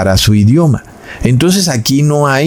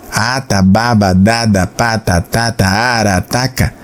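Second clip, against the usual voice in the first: put-on baby babble; 3 semitones lower than usual. Shown as a demonstration. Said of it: "igual que el de un bebé".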